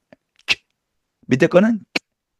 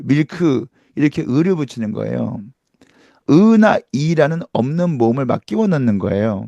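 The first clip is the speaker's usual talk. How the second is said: normal talking voice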